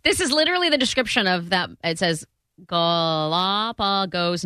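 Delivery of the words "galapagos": Galapagos spelled out slowly syllable by syllable; slow careful. Shown as a demonstration. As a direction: read Galapagos slowly and haltingly. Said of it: "I can't read any of that".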